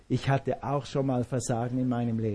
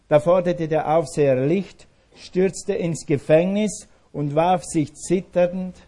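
normal talking voice

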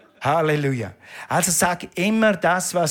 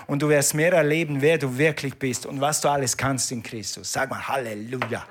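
usual speaking voice

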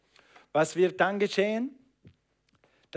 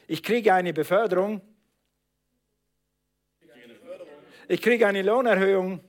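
modelled declaration; 3 semitones higher, 4 dB louder than usual. Shown as a demonstration. Preacher models a confession.

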